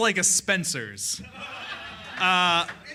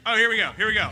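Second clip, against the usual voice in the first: ordinary delivery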